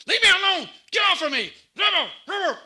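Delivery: high, loud, terrified yelling; frantic cries to be left alone; panicked shouting. Retold or imitated. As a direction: shout like a veteran reliving combat. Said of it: "he's like".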